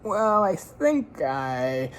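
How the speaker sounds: slow, halting start of a goodbye; abrupt hesitant visitor. A narrator's reading. Shy visitor trying to leave, halting. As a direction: clear the throat and falter. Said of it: "he rises and says abruptly".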